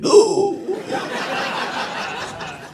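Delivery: high breathy mock gasp. Even the one Indian kid, high mock gasp.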